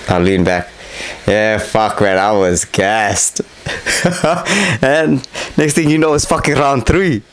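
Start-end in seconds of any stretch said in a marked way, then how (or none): 3.7-4.8 a short laugh up high; high breathy laugh. Real, not acted. Remembering how gassed he was, a rueful laugh.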